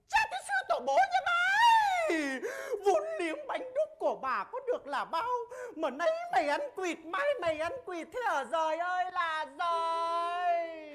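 high, shrill mock curse of an angry market woman; cake seller cursing. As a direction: clown imitating the market woman's tirade. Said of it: "Bà xoắn váy quay còng thế này".

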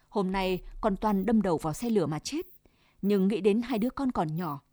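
ordinary delivery, nothing marked

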